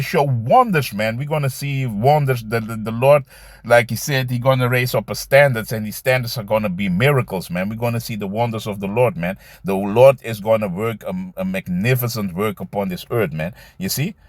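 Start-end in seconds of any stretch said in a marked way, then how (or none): none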